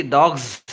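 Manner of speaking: డాగ్స్ drawn out into a single buzzing z; correct z ending. Shown as a demonstration. Teacher giving the correct plural, z ending. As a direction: end డాగ్స్ in a long "zzz", no extra syllable. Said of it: "జ sound".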